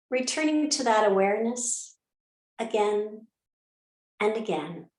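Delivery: calm centering voice, letting each instruction settle; guided meditation. Acted. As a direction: guide them into stillness phrase by phrase, unhurried. Leads an opening meditation.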